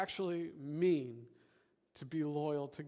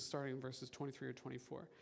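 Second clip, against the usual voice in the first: ordinary delivery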